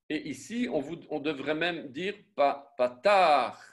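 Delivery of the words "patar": "patar" pushed out louder; insisting. Shown as a demonstration. Teacher insisting on the vowel, punched. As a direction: lean into "patar". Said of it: "Donc, on insiste".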